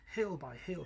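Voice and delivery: ordinary delivery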